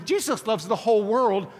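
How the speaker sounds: normal talking voice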